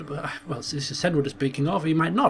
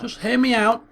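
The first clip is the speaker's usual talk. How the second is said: higher-pitched appeal; pleading envoy; 5 semitones higher, 3 dB louder than usual. Acted. Envoy interrupted by the High King, pleading to be heard. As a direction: beg for a hearing. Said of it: "Senrud's gonna have to explain".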